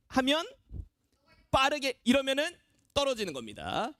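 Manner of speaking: ordinary talk, nothing marked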